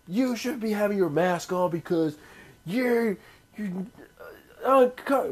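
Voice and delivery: high, loud California whine at someone; California accent. Acted. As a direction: scold in an exaggerated California accent. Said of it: "I'm doing a California accent".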